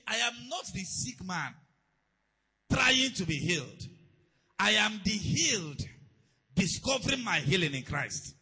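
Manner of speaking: slow, loud, emphatic proclamation; forceful declaration. Real, not acted. Preacher models a healing confession for listeners, declaring it forcefully. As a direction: proclaim it slowly with full conviction.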